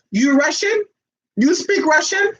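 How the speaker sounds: a lightly voiced suspicious girlfriend; high, curious woman.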